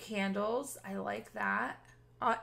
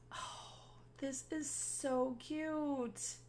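slow, much softer, nearly whispered; hushed awe; 4 semitones higher, 10 dB quieter, much slower than usual. Real, not acted. Walking into the pink bedroom, hushed and awed. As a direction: breathe it out in hushed wonder.

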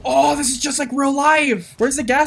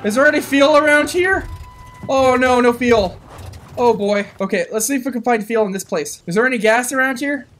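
ordinary talk, nothing marked

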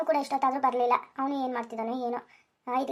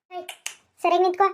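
higher and louder, barking at the cow; sharp command; 6 semitones higher, 6 dB louder than usual. Acted. Milking husband ordering the restless cow, loud sharp command. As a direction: make the animal stand still.

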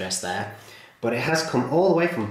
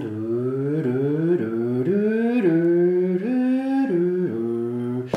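rising electric motor hum sung as doo; train sound effect; 6 semitones higher, much slower than usual. Shown as a demonstration. Demonstrating the train motor sound for viewers, rising doo hum. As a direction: hum it like a train pulling away. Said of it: "train sound effects".

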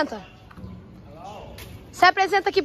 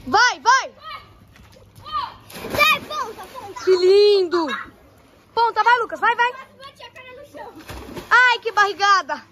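yelling from the sidelines; 7 semitones higher, 4 dB louder, slower than usual. Real, not acted. Cheering divers at pool.

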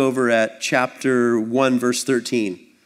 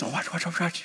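soft, higher-pitched mock anticipation; hushed onlooker; 5 semitones higher, 8 dB quieter than usual. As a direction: quietly imitate an eager onlooker. Imitates onlookers at a familiar custom.

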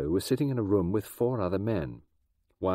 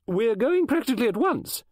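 high, lively gossiping woman voice; brisk chatty lady; 5 dB louder than usual. Acted. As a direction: chatter on like an eccentric novelist. Mrs Oliver hurrying them.

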